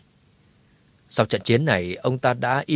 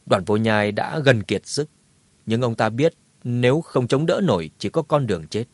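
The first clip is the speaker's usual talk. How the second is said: ordinary delivery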